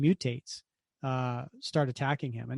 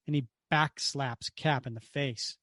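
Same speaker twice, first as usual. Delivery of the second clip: normal talking voice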